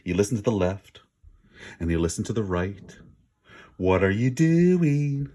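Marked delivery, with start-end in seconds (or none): 3.8-5.3 slow, drawn-out mocking question. A narrator's reading. Kip mocking the listening wolf, slow and taunting. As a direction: taunt him slowly. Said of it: "mocked Kip".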